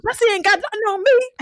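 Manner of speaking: high, crowing retold boast; imitating a man thrilled with his own feat; fired up. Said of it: "he's fired up".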